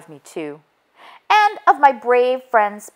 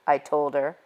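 ordinary delivery, nothing marked

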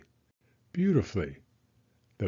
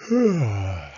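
tired sighing yawn; 4 dB louder than usual. Acted. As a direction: let out a sleepy yawn. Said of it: "Penny yawned".

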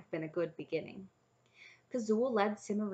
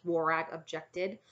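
ordinary delivery, nothing marked

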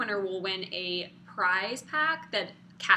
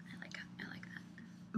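whispered; 17 dB quieter than usual. Real, not acted. Hushed aside.

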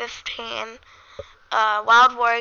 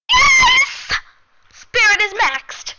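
excited cheer; 11 semitones higher, 8 dB louder, slower than usual. Real, not acted.

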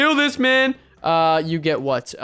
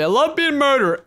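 sarcastic; 8 semitones higher, 4 dB louder than usual. Real, not acted. Sarcastic after being murdered.

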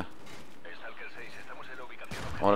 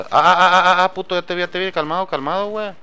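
excited; 7 semitones higher than usual. Real, not acted. Spotting an enemy mid-fight.

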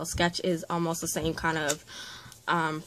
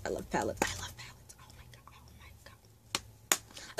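excited, breathless-soft whisper; awed whisper; 9 semitones lower, 12 dB quieter than usual. Real, not acted. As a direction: whisper it, overcome with delight. Overwhelmed by seeing the palettes.

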